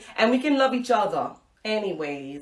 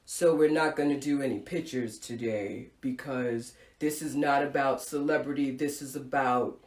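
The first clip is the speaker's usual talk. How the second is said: teacher's voice; 8 semitones lower, 5 dB quieter than usual. Retold or imitated. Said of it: "and then he says".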